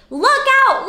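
ordinary delivery, nothing marked

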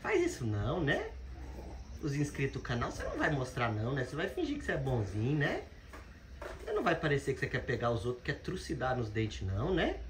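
soft, fast, laughing teasing; soft laughing teasing of the dog. Real, not acted. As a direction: tease the dog gently, half laughing.

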